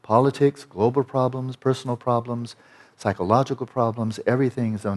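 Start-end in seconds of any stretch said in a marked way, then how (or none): none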